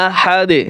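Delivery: আহাদে with a bounced, echoing final d; qalqalah stop. Shown as a demonstration. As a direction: pop the d at the end of আহাদে. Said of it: "আমরা বলি কি?". (শেষে দালে কলকলা করে আহাদে)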